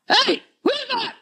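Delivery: high indignant call-out. Retold or imitated. Mahaprabhu hears the book read and demands who it is, indignant. Retelling Mahaprabhu's reaction on hearing.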